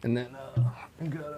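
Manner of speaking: soft unvoiced whisper. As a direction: whisper it aside so nobody hears. Aside during a classroom scene.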